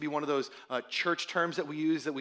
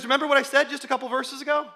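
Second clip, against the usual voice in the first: excited; 8 semitones higher, 6 dB louder, faster than usual. Retold or imitated. Voicing Paul, quick question.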